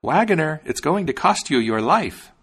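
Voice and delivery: high, loud sparrow's threat; calling out. A narrator's reading. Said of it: "called out".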